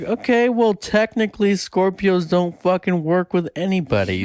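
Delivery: high-pitched, indignant comeback; exasperated retort. Retold or imitated. Retelling her retort to a Scorpio.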